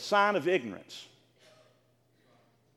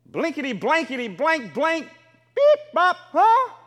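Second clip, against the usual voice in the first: mock cursing; 5 semitones higher, 6 dB louder, slower than usual. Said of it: "What do you want them saying?".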